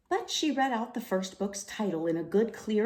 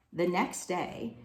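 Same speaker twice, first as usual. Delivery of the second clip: ordinary delivery, nothing marked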